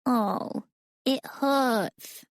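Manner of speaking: put-on milk the pain like a small kid.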